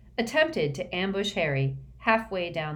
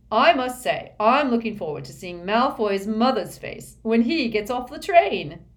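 louder, relishing voice of a pompous schoolboy; Ernie, smug; 5 semitones higher, 8 dB louder than usual. A narrator's reading. Ernie gloating over the slug-like Malfoy, louder and smug. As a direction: savour every word of it. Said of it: "said Ernie with some satisfaction".

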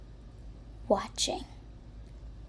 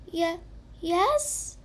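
shocked; 8 semitones higher than usual. Acted. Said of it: "said Toilet Paper in shock".

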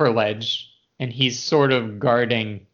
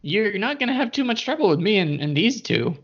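a lightly raised, sly goblin voice; 9 semitones higher than usual. Acted. Goblin Yeemik offering a truce to the party, lightly higher sly voice. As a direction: play the scheming goblin, a touch higher.

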